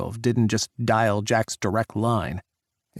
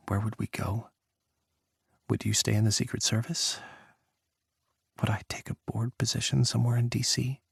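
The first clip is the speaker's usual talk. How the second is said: softer, half-whispered musing; hushed to a ghost; 5 dB quieter than usual. Acted. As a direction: murmur it as if he might hear. Jack talking to dead lover.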